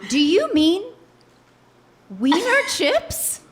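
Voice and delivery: ordinary delivery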